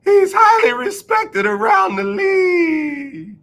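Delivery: very high, slow, mock-wailing; exaggerated sarcasm. Shown as a demonstration. Showing fans how to praise Wentz, hammed-up mock cry. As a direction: stretch it out so nobody takes it seriously. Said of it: "don't say it like that".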